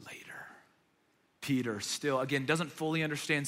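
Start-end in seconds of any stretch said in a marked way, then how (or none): 0.1-0.5 whispered. Retold or imitated. Jesus promising future understanding.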